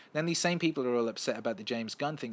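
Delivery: ordinary talk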